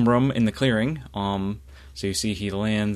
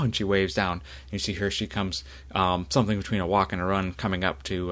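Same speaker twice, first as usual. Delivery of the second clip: normal talking voice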